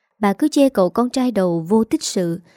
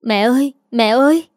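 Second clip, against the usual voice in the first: daughter-in-law banging the door, calling in fear; frightened calling out; 3 semitones higher than usual. A narrator's reading. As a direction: call through the locked door in fright. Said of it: "sợ hãi vừa kêu vừa giật cửa".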